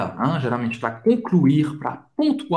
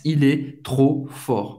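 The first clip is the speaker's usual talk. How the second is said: slow, deliberate French; unhurried teacher read; slow demo; slower than usual. Shown as a demonstration. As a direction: say "Il est trop fort" slowly and clearly. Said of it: "Você vai confirmar".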